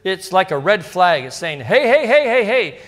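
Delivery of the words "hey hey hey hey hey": higher, louder hey hey to grab attention; urgent wake-up call. Pretend. Scripture voiced as calling out.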